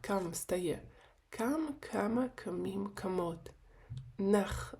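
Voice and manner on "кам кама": "кама" with "ка" stressed, as in colloquial speech; stress on first syllable. Shown as a demonstration. Teacher models speech heard in Israel, "кама" stressed on the first syllable. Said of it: "по принципу розмовної мови".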